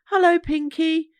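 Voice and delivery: calling out. Acted. Calls into noisy room.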